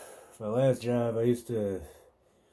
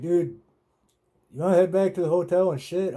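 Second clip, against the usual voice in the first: higher, louder buddy voice; coworker's voice, pitched up and loud; voicing Tony; 7 semitones higher, 5 dB louder than usual. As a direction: play the coworker cracking up in agreement. Voicing Tony's suggestion.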